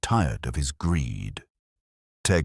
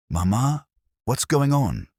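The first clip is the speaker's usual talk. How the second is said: higher, bewildered question; confused; 9 semitones higher, 3 dB louder than usual. A narrator's reading. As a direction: ask in confusion, voice climbing.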